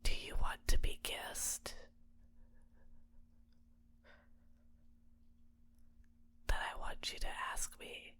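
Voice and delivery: hushed, seductive whisper; intimate whisper. Acted. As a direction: whisper it close, as an invitation. Intimate offer of a kiss.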